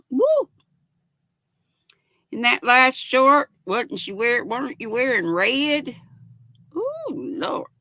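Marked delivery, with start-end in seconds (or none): 0.1-0.5 high squealed woo; a little excited cheer. Real, not acted. 6.8-7.7 high, airy exclamation; high delighted ooh lord. Real, not acted. Reacting to pretty outfit.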